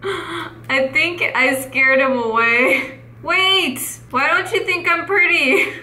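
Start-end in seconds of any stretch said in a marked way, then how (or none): 3.2-5.7 high plea breaking into laughter; hammed-up wounded appeal, half laughing; mock hurt protest. Calling after the player who said no, mock hurt and laughing.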